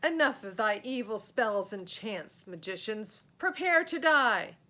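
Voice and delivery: loud, rising to a bellowed threat; menacing knight. Acted. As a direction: threaten them, then roar the final words.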